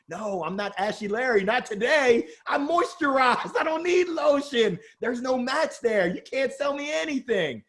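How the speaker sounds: mock indignant refusal. Brushing off the kiosk seller, hammed-up indignant shout.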